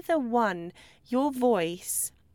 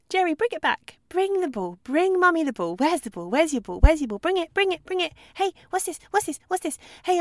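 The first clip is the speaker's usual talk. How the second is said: high, excited, nonstop cheerleading at a dog; overexcited owner babbling at her dog on a walk; 7 semitones higher, 5 dB louder than usual. Retold or imitated. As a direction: gush and chatter at the dog without a breath. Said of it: "that's stressful just listening to that".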